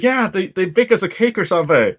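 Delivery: high-pitched imitated reply; mock game character. Game character recalling a cake, high mock voice.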